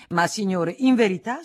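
loud, rising objection; indignant protest. A narrator's reading. Federico objecting to the viscount's offer, indignant protest. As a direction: protest sharply, climbing in pitch.

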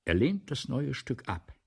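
ordinary talk

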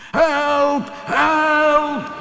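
very high, drawn-out cry for help; panicked storybook yell, held long. A narrator's reading. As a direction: scream for rescue while falling. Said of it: "riep Ben".

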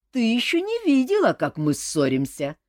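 exclaiming. A narrator's reading. Cousins teasing Olga, exclaiming. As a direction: boast it together. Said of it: "хором воскликнули они".

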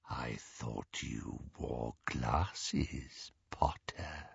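very slow, low near-whisper; breathed menace. A narrator's reading. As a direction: breathe it out softly, deep and threatening. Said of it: "breathed Greyback".

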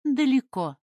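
high, loud, enigmatic answer. A narrator's reading. Woman hides her destination from the young man, enigmatic. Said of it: "загадочно сказала она".

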